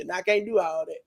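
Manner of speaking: ordinary delivery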